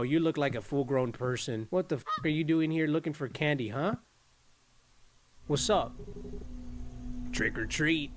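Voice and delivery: normal talking voice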